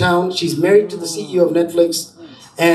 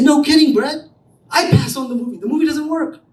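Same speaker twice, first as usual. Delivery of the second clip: mock indignant retort; 8 semitones higher than usual. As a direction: fire it back at him, exasperated, pitched up. Retold argument with investor.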